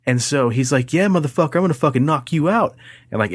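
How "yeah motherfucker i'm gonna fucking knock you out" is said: raised-pitch imitation of a bully's threat; mock threat. The bully threatening to knock him out, high mock threat. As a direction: ham up the tough guy, pitched high.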